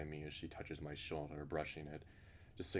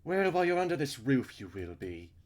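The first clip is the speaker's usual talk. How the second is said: high, loud opening, settling back down; overbearing house rule; 8 semitones higher, 10 dB louder than usual. Acted. Missus Burns overruling Jim under her roof, overbearing. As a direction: lay down the law from a high, loud start.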